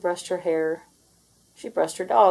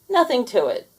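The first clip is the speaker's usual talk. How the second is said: louder, lifted boast; breezy confidence; 5 semitones higher, 6 dB louder than usual. A narrator's reading. Libby in the garden, lightly boastful. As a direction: shrug it off brightly. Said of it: "said Princess Libby".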